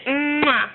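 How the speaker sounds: exaggerated smooching "mwah"; kiss sound. Shown as a demonstration. Prompting toddler to kiss the toy tiger, playful smooch. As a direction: hum into a big pretend kiss.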